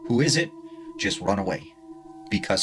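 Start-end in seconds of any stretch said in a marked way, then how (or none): 0.1-0.5 higher, louder call into the woods. Retold or imitated. Warning listeners not to call back, a louder mock call. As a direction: call out who's there, a bit louder. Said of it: "Do not say".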